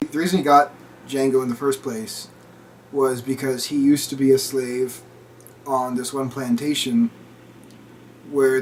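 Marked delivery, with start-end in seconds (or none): none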